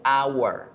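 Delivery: "hour" said as "awar", no H; silent H. Shown as a demonstration. Teacher contrasts it with hospital, "hour" with mute H. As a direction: start "hour" straight on the A vowel. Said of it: "la H no suena".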